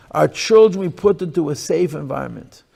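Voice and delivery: ordinary talk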